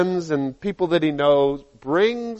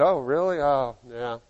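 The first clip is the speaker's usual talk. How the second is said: much softer, doubtful mumble; dismissive friend; 4 semitones lower, 7 dB quieter, faster than usual. Acted. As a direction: trail off unconvinced. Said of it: "when you talk to your other friend".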